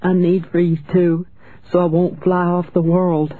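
put-on high little girl's voice; small, frail voice. A narrator's reading. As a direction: plead like a frail child, pitched high. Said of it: "in a small, frail voice".

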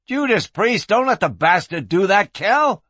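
high-pitched furious outburst; raging. A narrator's reading. Flynn urges Kel against the lawman, raging. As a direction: rage at your partner to act. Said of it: "Curly Flynn raged".

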